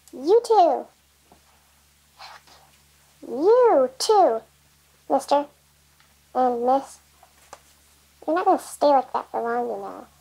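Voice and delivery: ordinary talk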